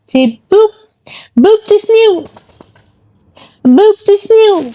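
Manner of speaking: high sing-song "snoot"; silly high cooing boop. Real, not acted. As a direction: play with the dog in a silly high voice. Playful baby talk to dog.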